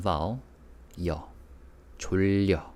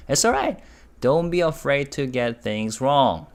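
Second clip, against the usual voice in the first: reassuring; 6 semitones higher, 4 dB louder than usual. Real, not acted. Reassuring a wrong learner.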